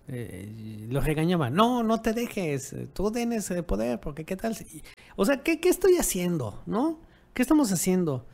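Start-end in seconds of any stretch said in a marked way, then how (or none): 1.5-4.8 coaxing his dog to fight back; urging the dog on. Retold or imitated. 5.1-8.2 sudden high, loud self-reproach; high alarmed what am I doing; shocked at himself. Real, not acted.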